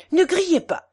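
put-on German-accented plea. German friend hushing the struggling portress, German-accented plea. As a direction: plead in a thick German accent.

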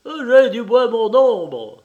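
loud, high, drawn-out taunting call; ironic jeer. A narrator's reading. The fleeing little one repeats his boast from outside, ironic. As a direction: call out slowly in mocking triumph. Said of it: "ricaner dehors et répéter ironiquement".

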